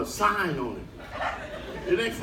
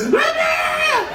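pleading yell. Retold or imitated. Comedian begging the crew to open his cabin, high crying yell. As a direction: wail to be let in.

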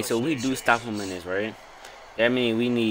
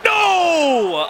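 high, loud, drawn-out refusal; sudden loud cry of despair; anguished shout; 9 dB louder than usual. Real, not acted. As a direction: yell it in real dismay. Losing, cries out.

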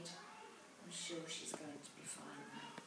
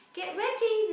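high loud call to the class; teacher's cry; 14 semitones higher, 17 dB louder than usual. Acted. Teacher calling flying class to get ready, loud. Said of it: "the teacher cried".

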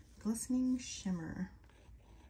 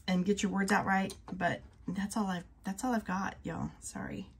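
usual speaking voice

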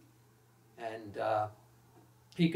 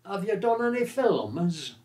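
higher, louder, blunt lad's voice; brash reporter's question; 7 semitones higher, 6 dB louder than usual. Retold or imitated. The young reporter quizzing Olivier, brash and loud. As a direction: fire it off like a clueless cub reporter. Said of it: "And this voice says".

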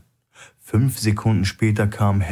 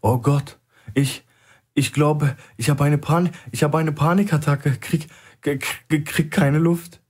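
high, gasping and stammering, slowing at the end; stuttering for air, hammed-up panic; faked panic attack; 8 semitones higher than usual. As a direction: fake a panic attack, short of breath. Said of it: "griff Celeb sich an die Brust und schnappte nach Luft".

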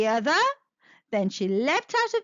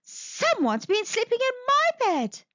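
higher, motherly bear voice; 9 semitones higher than usual. Acted. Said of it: "said Mother Bear".

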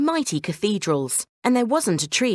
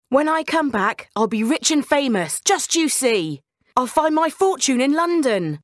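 put-on boastful young Dick; 7 semitones higher than usual. Dick's hopeful boast leaving home.

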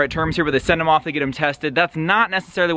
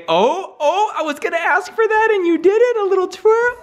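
delighted praise. Real, not acted. Praising daughter's dance.

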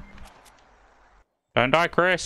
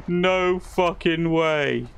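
slow, drawn-out, higher disbelief; amazed disbelief; 5 semitones higher, much slower than usual. Real, not acted. Gamer reacting to a lucky escape, incredulous. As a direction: stretch each word in amazement.

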